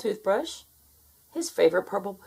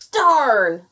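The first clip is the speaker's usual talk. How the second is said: loud, higher-pitched cry of frustration; screamed; 4 semitones higher, 10 dB louder than usual. A narrator's reading. Gnome, still cold in Alaska, screaming. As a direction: let it burst out as a scream. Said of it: "he screamed".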